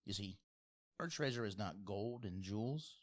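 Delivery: ordinary talk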